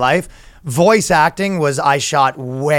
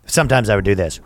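usual speaking voice

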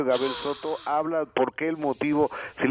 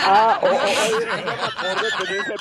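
habla entre carcajadas, más agudo y fuerte; riendo; 9 semitones higher, 4 dB louder than usual. Real, not acted. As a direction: suelta la risa al recordarlo. Se ríe al recordar. (hablando entre carcajadas)

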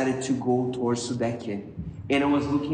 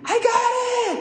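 very high mock shriek; imitated scream; 3 dB louder, faster than usual. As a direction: shriek it like the excited woman. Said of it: "she starts screaming".

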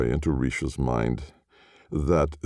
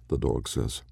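ordinary delivery

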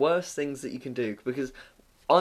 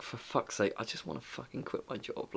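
exasperated under the breath; fed-up muttering; 2 semitones lower, 14 dB quieter than usual. Retold or imitated. Phone shop worker wanting to quit, fed-up mutter.

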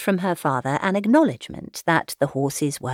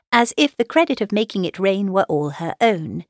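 ordinary delivery

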